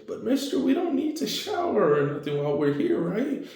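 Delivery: put-on high, worried little kid; child voice. Child checks on Deku after his flashback, high worried child voice. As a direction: ask like a small worried child.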